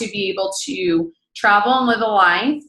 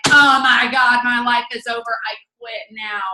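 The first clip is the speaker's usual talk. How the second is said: hammed-up overreaction to bad news; 4 semitones higher, 4 dB louder than usual. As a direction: wail it, then give up. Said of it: "talking about highs and lows".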